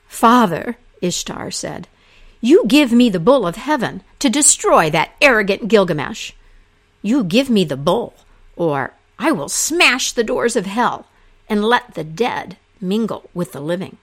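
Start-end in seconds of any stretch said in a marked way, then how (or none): 0.1-0.8 high, loud call to her father; a goddess loudly demanding her father's ear. Acted. 2.4-14.0 a goddess threatening to smash the doors of hell; angry demand. Acted. Goddess threatens her father.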